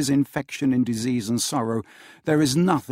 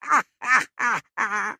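high hearty group laughter; lusty roaring laugh; 9 semitones higher than usual. A narrator's reading. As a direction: roar with laughter. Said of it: "roared out lustily".

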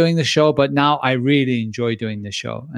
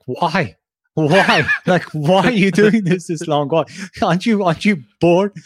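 higher, louder mocking comedian voice; Ricky Gervais; 5 semitones higher, 3 dB louder than usual. Mocking a talk show host in a lightly voiced Ricky Gervais impression. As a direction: needle the host, incredulous and amused. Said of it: "Ricky Gervais is like".

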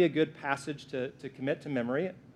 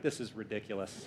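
low, much softer grumbling aside; mock skeptic grumble; 7 semitones lower, 12 dB quieter than usual. Pastor voicing doubtful listeners, low mock grumble. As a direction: mutter it as an unimpressed churchgoer. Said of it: "some of you are like".

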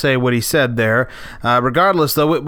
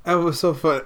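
usual speaking voice

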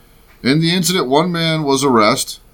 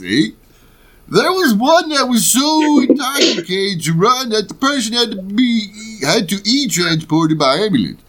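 exaggerated mock Ohio accent, swooping up and down; 5 semitones higher than usual. Host reads sheriff's account of drunken partygoer, in a hammed-up Ohio voice. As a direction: ham up a comic Ohio voice. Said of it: "read it in the Ohio voice".

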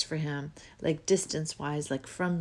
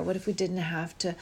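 ordinary talk

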